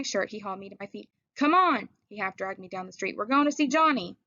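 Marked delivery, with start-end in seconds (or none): 1.4-1.9 higher, louder urgent call. Acted. Dally dragging Ponyboy to see Johnny, urgent call. 3.2-4.2 higher, louder urgent call. Acted. Dally dragging Ponyboy to see Johnny, higher urgent call.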